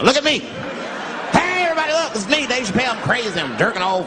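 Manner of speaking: hollering at the neighbors, way up high; manic self-display. Pretend. Acted self calling out from window.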